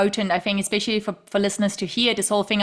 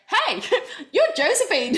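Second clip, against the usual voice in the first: laughing imitation; 9 semitones higher than usual. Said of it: "who's like".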